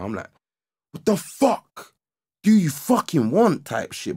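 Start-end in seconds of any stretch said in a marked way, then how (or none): 0.9-3.6 high-pitched, drawn-out angry demand; retold anger. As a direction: act out confronting the intruder angrily. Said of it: "started getting a bit angry".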